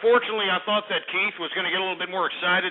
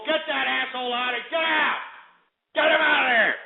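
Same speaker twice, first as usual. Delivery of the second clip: shouting; 6 semitones higher than usual. Acted. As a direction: yell them out of the room. Shouting someone out.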